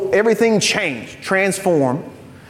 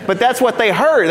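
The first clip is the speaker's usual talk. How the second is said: ordinary delivery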